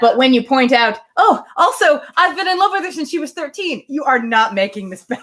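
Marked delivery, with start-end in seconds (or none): 1.2-3.8 high-pitched mocking quote of his line; hammed-up parody of a clueless suitor; mocking the older man's confession; mock Knightley.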